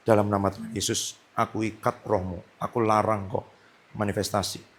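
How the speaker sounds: low, soft, unforced command; quiet authority. Retold or imitated. Minister quietly binds a spirit during worship, low and soft. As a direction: keep the rebuke low and hushed. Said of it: "Saya bilang ini".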